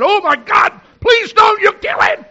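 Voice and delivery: high, loud frantic wife's cry; panicked shout. Retold or imitated. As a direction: scream it as a terrified wife.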